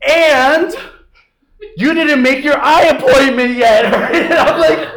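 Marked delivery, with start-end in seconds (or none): 0.1-3.9 higher, louder nagging mom; mom piling on. Retold or imitated. Mom nagging her kid about the eye appointment, higher and louder. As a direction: pile on one more grievance, higher and louder. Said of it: "my mom's like".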